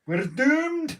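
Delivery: high, loud doom-laden wail; mock doom cry. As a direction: wail it like the end is nigh.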